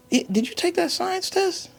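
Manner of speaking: high, sweet, too-casual mom voice; a mother fishing for the truth in a lilting high tone; imitating mom. Mother probing her son about the test, high and sing-song.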